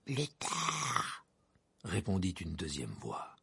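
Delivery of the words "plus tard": a lightly voiced icy master; cold and curt, a hint of menace; cold brittle frosty whisper. A narrator's reading. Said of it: "étrangement aiguë et froide comme un coup de vent glacé".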